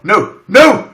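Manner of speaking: loud, high cry of no; horrified shout. Retold or imitated. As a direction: yell in sudden horror. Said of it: "snapped out of it and was like".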